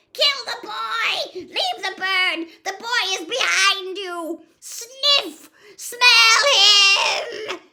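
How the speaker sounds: high, loud frantic commanding shrieks; screaming villain. A narrator's reading. Dark wizard screaming orders at the blind serpent. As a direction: bark orders at the snake, hysterical.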